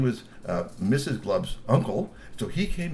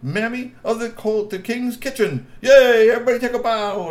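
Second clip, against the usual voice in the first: proud troll host; 11 semitones higher, 7 dB louder than usual. Acted. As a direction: present the family like a proud showman. Glub introduces family.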